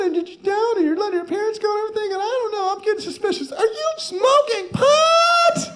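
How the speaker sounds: a nagging mom grilling her son; imitating worried mom. Mother confronts her son about his grades and pot. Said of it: "she just looks at me and says".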